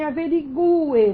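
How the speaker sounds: averigue as a-ve-ri-GU-e; hiatus, stressed U. Shown as a demonstration. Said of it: "você tem uma separação".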